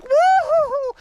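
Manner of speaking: high, loud whooping cheer; hammed-up carefree yell; wild whoop. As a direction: cut loose with a joyful woo-hoo.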